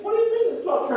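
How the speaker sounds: mocking a stranger's shocked question; incredulous. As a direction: imitate the listener's shocked outrage. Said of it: "they went".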